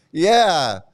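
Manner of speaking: ordinary delivery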